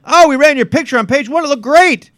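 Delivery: lightly hammed-up cheerful boss; mock cheerful editor. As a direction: sell it like a chirpy editor. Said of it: "You literally can say to them".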